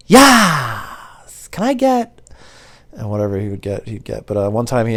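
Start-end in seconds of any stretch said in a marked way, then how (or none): 0.1-2.2 high, drawn-out mock caller voice; odd caller. Regular caller starting a pizza order, imitated high and loud. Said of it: "He'd just start with".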